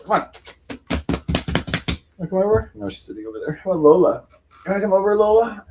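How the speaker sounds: high, sweet coaxing voice. Real, not acted. Asking her cat Lola if she can come over, high baby talk.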